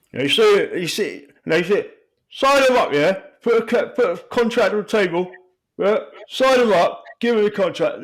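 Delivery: high, loud, excited manager voice; Harry Redknapp impression. Football manager urging a club to sign him. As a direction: sell the signing like a gushing manager. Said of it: "That sounded like Harry Redknapp".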